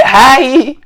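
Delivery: high affected aïe; imitating his aïe. The teller copying the friend's habitual affected exclamation, high. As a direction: mimic his fussy little aïe. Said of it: "souvent il fait".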